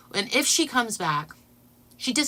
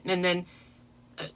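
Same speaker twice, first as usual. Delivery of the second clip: ordinary talk, nothing marked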